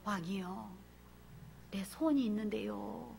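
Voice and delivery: soft, low humble plea; lightly voiced humble queen; timid petition. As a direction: approach the king meekly. Esther voiced petitioning king.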